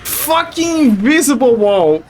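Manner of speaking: slow, loud frustrated curse; angry, drawn-out swearing. Real, not acted. Blocked by invisible wall.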